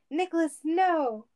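high, drawn-out cry of protest; yell. A narrator's reading. Sonic stopping Cage smashing the glass, acted yell. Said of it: "Sonic yelled".

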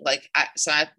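ordinary delivery

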